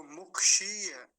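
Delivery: मुक्षीय loud and slow, shee stretched; long ī. Shown as a demonstration. Teacher giving the correct word, long ī. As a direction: hold the ī in मुक्षीय. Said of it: "और लंबा खींच के बोलेंगे".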